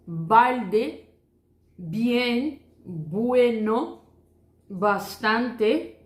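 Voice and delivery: Bonito, buenas días said very slowly, lips together on the b; slow clear demo. Shown as a demonstration. Teacher slowly modeling the Spanish b for students. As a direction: stretch each word slowly for learners. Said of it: "Você junta los labios".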